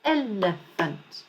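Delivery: slow Elephant, el-e-phant in three even beats; slow clapped syllables. Shown as a demonstration. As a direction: stretch Elephant out beat by beat. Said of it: "It has three beats".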